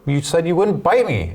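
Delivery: high, indignant mock protest; betrayed outcry. The bitten man in the fable protests to the snake, mock betrayed and high. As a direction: cry out in hurt disbelief, pitched well up.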